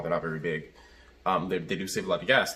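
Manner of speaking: usual speaking voice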